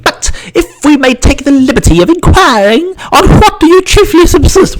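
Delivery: put-on a tall gangling boy in a stupid hat; Slingsby asking the fly, very high and goofy; Slingsby's voice. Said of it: "said Slingsby".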